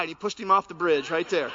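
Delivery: ordinary delivery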